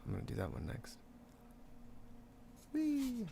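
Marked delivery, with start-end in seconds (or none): 2.7-3.3 playful high squeal. Real, not acted. Playful noise while painting.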